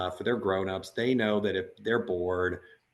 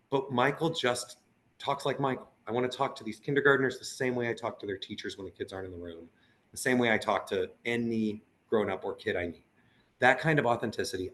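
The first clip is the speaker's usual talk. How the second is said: usual speaking voice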